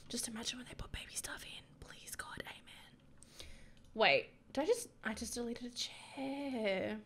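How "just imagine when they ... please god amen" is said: hushed whispered little prayer; whispering. Real, not acted. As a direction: whisper it like a wish. Hoping for baby items.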